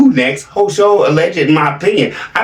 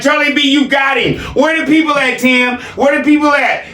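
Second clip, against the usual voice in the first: higher-pitched mock egging-on; hammed-up demanding heckle, pitched up; imitating Peanut Game; 8 semitones higher than usual. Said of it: "Peanut Game sitting here like".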